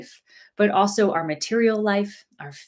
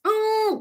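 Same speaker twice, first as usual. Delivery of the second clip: high, held hum of affection; hugging squeal; 14 semitones higher than usual. Pretend. Giving online friends a big hug, high affectionate hum. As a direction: squeeze out a high pretend-hug "mmm".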